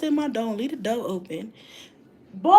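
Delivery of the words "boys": calling out. Real, not acted. Calling the boys.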